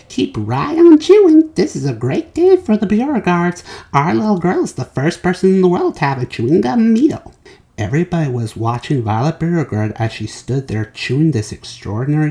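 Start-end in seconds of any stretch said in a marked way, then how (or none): 0.1-7.3 lightly voiced proud dad, pitched a bit higher; beaming father's cheer, only lightly voiced. A narrator's reading. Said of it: "said Mister Beauregard".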